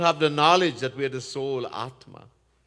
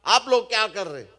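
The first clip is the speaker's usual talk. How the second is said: raised, rapid royal questioning; king's demand; 6 semitones higher, 4 dB louder, much faster than usual. Retold or imitated. King to his palanquin bearers, demanding. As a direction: fire off the questions as a startled king. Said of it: "राजा रघुगण ने कहा".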